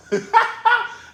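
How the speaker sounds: a sudden high burst of laughter. Real, not acted. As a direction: crack up hard. Laughing at the situation.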